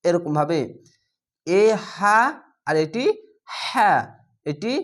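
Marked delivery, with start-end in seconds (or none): 1.5-2.2 হা with air pushed out first; breathy ha demo. Shown as a demonstration. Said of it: "প্রথমে বাতাস বের করছি". 3.6-3.9 হা said with a push of breath; a firm breathy হা; Arabic ha sound. Shown as a demonstration. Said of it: "শুধু হা".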